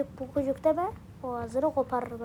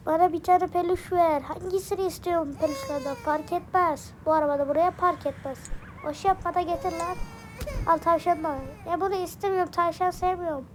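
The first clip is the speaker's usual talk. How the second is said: put-on higher, bratty toddler voice; cheeky baby voice; 5 semitones higher than usual. Baby Osman sasses his caretaker, cheeky higher toddler voice. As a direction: talk back like a sassy little kid.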